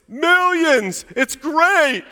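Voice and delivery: put-on high, loud excited boy's voice from downstairs; kid's voice. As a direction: play the gleeful twelve-year-old shouting back. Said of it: "I just hear this voice".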